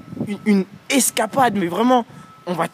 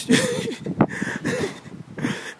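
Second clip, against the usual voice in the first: high, breathy burst of laughter; long high-pitched laugh; 5 dB quieter than usual. Real, not acted. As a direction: crack up before telling the example. Laughs before giving his example.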